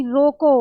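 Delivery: "रोको" loud and drawn out, then a halt; full stop after रोको. Shown as a demonstration. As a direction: land firmly on "रोको" and stop. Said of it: "रोको के बाद क्या दे दिया".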